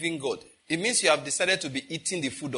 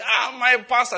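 high, loud mock-delighted "Ah, my pastor"; imitating an overeager churchgoer hailing his pastor; gushing greeting; 8 semitones higher, 6 dB louder than usual. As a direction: ham up a fawning hello. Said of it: "he saw me".

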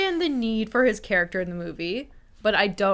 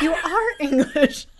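much higher, slow, through laughter; laughing accusation; 9 semitones higher, 5 dB louder, slower than usual. Retold or imitated. Host accusing the da Vincis, laughing hard. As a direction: blurt it while cracking up. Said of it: "And you're like".

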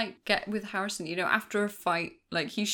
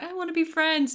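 sweet high mock plea; 11 semitones higher, 5 dB louder than usual. Deanna quoted.

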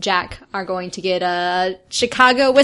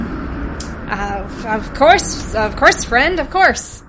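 eager barber; 3 semitones higher, 3 dB louder, much slower than usual. Acted.

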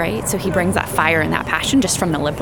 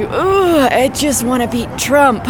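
eager; 7 semitones higher, 4 dB louder, slower than usual. Retold or imitated. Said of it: "then I go into".

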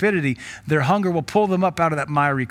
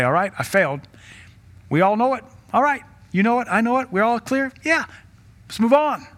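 brushing off failure; 7 semitones higher than usual. Pretend. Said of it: "We have to at some point say".

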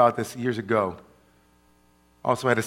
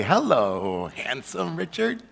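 breathy vintage purr; 4 dB quieter, slower than usual. Acted. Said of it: "tell you the truth this way".

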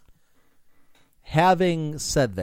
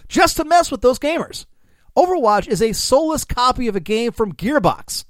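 raised, punchy satirical reading; mock corporate speech; 6 semitones higher, 4 dB louder than usual. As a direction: read it like a smug executive. Reads fake CEO quote aloud.

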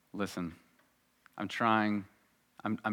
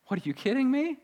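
incredulous scoff; 13 semitones higher than usual. Acted. Peter scoffing at accusation.

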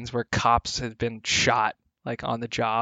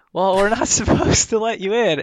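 higher, louder no that breaks into a laugh; laughing refusal; 8 semitones higher, 5 dB louder than usual. Retold or imitated. To the angry dog owner, a laughing refusal. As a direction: refuse him while laughing at the absurdity.

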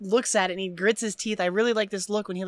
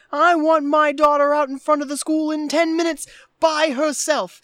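louder, higher blustering put-on voice; mock demanding father; 7 semitones higher, 6 dB louder than usual. Imitating Cameron as a father demanding his daughter, mock outrage. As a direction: issue it as a hammed-up order.

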